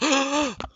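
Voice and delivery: sharp high crowd gasp; mock gasp of shock. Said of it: "like, they're shocked".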